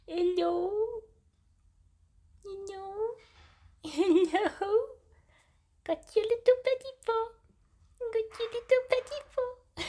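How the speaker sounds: very high, sing-song pet voice. Real, not acted. Cat sitter greeting a kitten's paw, high baby talk. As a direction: coo sky-high to a tiny animal.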